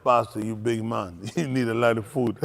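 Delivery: lightly voiced Jamaican man; low cook voice. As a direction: drop into the cook's voice.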